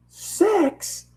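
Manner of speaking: squeaking high disbelief; dad stunned at the number of ponies; shocked dad. Acted. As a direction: gasp it out in shock.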